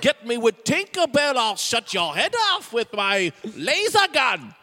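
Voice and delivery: hammed-up pirate threats; pirate character. Pirate parrot threatening the captain, pirate character.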